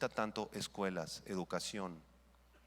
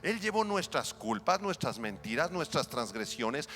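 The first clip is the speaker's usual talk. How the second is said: loud, higher climactic preaching over music; 7 semitones higher, 6 dB louder than usual. Acted. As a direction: build the list to a peak. Climax on the cross's meaning. (clímax de predicación, fuerte y agudo)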